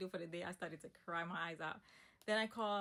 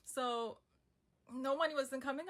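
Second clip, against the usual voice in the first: ordinary talk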